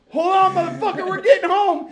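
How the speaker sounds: high, loud, laughing growl of resolve; determined shout. Retold or imitated. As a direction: driver shouting to his friend while flooring it, high and loud. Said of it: "And I was like".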